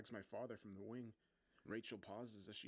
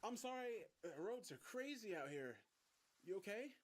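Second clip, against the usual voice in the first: raised, high-pitched calling; shouting over rain; 11 semitones higher than usual. A narrator's reading. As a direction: project urgently through a downpour. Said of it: "I shouted over the rain".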